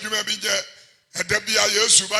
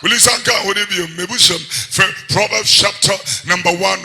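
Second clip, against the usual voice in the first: ordinary talk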